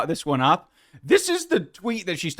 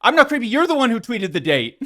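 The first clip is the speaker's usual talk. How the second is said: higher, louder whiny mock of her; mocking imitation; 6 semitones higher, 3 dB louder, faster than usual. Host imitating the podcaster's defence, whiny mock. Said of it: "are now bringing it up as".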